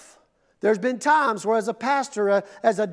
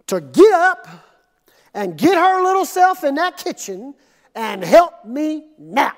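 much higher, loud exasperated outburst; storming demand; 5 semitones higher, 7 dB louder than usual. Retold or imitated. Martha storms in and demands Jesus send Mary, loud and exasperated. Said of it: "she comes storming around the corner".

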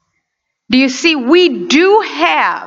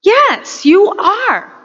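emphatic answer; 8 semitones higher, 3 dB louder, much slower than usual. Real, not acted. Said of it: "preacher's own loud answer".

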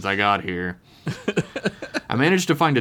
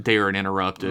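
ordinary delivery

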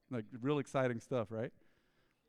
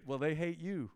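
usual speaking voice

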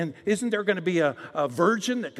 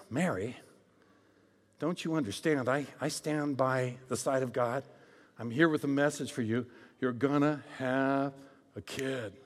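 calm angel Gabriel; 6 semitones lower, 6 dB quieter than usual. Acted. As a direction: lower and softer, a hushed messenger. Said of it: "what Gabriel says".

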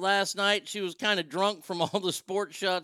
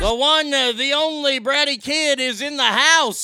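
higher, louder hype announcement; hype intro; 7 semitones higher, 6 dB louder than usual. Real, not acted. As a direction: hype up the caller's arrival. Host hyping caller's entrance.

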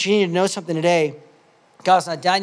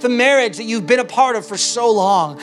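preaching at full force; 6 semitones higher, 5 dB louder than usual. Acted. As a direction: hammer home the promise again and again. Preaching God's voice.

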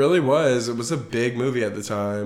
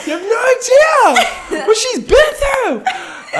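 high, loud, slow laughing outcry; laughing shout; 10 dB louder, slower than usual. Real, not acted. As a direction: shriek it out, laughing. Mock-dramatic outburst.

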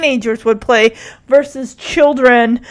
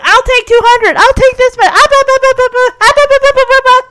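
high-pitched, loud mock buying frenzy; clamouring investors; 11 semitones higher, 5 dB louder, faster than usual. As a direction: babble over each other like eager bidders. Investors clamouring to buy, acted.

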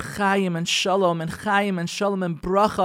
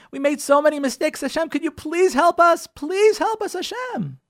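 pleading, climbing higher and higher toward a desperate peak; pleading prayer; 4 semitones higher than usual. Retold or imitated. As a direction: beg God for help, rising in pitch. Voicing congregants begging Hashem.

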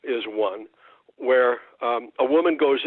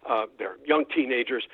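usual speaking voice

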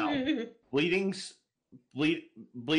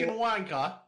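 mimicked British insult, pitched up; 7 semitones higher than usual. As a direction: echo it in a caricature British accent. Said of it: "It's a good stereotype voice".

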